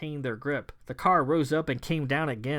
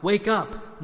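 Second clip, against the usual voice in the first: louder wake up; 7 semitones higher, 4 dB louder than usual. Acted. King rouses the stunned friends, Wake up! called louder.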